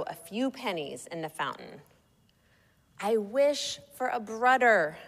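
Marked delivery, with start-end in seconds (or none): 3.0-5.0 put-on small child's raised, higher voice; lightly yelled, toddler lisp and all; yelling toddler. A narrator's reading. Child wishing at the mall fountain, yelling in a toddler voice. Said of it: "my child yelled with every splash".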